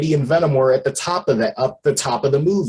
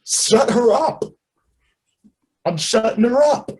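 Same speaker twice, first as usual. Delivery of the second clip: Cletus's voice; 10 semitones higher than usual. Retold or imitated. Cletus complaining to the symbiote, high whine. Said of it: "Cletus is just like".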